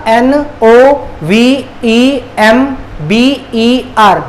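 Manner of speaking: slow, letter by letter; spelling out. Shown as a demonstration. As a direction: spell it out slowly with a gap after each letter. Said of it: "नवंबर का spelling क्या हो जाएगा". (एक-एक अक्षर धीरे-धीरे)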